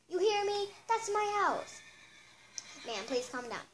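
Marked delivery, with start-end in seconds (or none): 0.1-1.8 raised, higher voice, insisting hard; angry rant. Acted. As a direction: jab every "my house" at the clerk. Angry guest ranting about her house.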